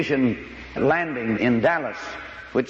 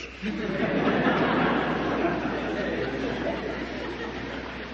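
soft breathy laugh; 6 semitones higher, 6 dB quieter than usual. Real, not acted. Laughing at own punchline.

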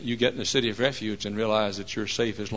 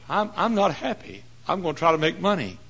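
higher-pitched imitation at ordinary volume; unhappy seeker; 7 semitones higher than usual. Unhappy person planning to make money, lightly acted higher voice. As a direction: lightly voice someone chasing happiness.

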